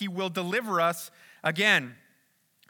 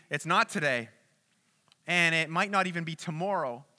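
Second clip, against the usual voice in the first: usual speaking voice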